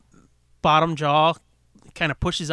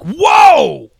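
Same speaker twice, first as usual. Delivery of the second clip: loud, high whoa; surprised shout; 8 semitones higher, 13 dB louder than usual. Retold or imitated. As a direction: yell it in delight. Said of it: "it's like".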